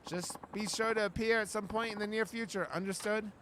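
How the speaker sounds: ordinary talk, nothing marked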